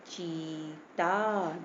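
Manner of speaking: चीता very slow, "cheee-taaa"; long drawn-out vowel. Shown as a demonstration. Teacher showing the long ee matra takes time, drawn out. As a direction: stretch both vowels of चीता. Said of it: "चा पे थोड़ा time लगा ना".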